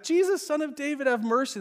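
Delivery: high, calling out over the crowd; blind man calling out. Retold or imitated. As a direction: raise the voice high to be heard. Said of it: "but then they've got".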